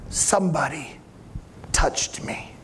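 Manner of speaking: whispered, low and intimate; a hushed whisper of a mystery; hushed secret. Acted. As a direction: lean in and breathe it. Jesus insists to Peter.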